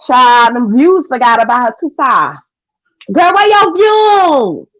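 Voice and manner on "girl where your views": slow, higher taunting sing-song; mock taunt. Real, not acted. Taunting the rival about her views, slow and high. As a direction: stretch it out to rub it in.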